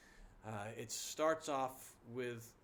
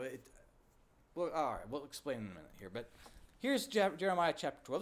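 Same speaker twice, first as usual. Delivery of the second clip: ordinary delivery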